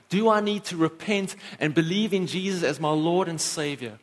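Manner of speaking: ordinary talk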